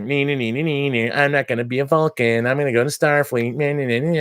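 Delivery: mock him like a sulky kid; whiny mockery. Mocking Spock defying the Vulcan Council, childish whine. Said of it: "he's like".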